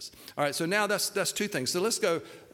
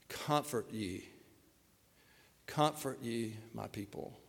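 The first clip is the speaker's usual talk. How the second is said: hushed, lowered voice recalling the aria; softly quoting the tenor; 4 semitones lower, 7 dB quieter, slower than usual. Retold or imitated. Pastor hushed, recalling the tenor's Messiah line, soft. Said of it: "the tenor steps to the front of the stage and sings".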